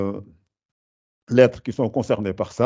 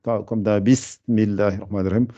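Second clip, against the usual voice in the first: normal talking voice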